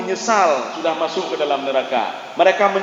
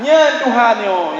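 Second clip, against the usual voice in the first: acted exclamation; 5 semitones higher, 5 dB louder, much slower than usual. Ibrahim voiced pointing at sun.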